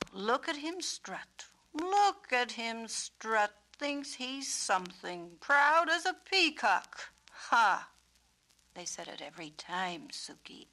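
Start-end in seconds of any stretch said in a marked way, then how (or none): 0.1-7.9 high, slow jeering voices; mocking onlookers. Peacock imitating cruel onlookers jeering. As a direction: sneer and laugh at the strutting bird.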